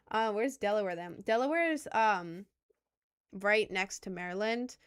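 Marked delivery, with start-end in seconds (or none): none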